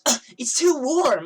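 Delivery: loud, high, drawn-out whine; bitchy complaining. Retold or imitated. Girl complaining as she gets her toast, bitchy whine. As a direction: mimic her disgusted griping. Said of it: "she just keeps bitching and complaining".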